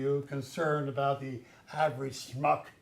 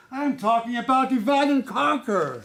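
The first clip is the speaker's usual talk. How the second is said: forceful boss; 6 semitones higher, 6 dB louder than usual. Acted. Boss lecturing underling firmly.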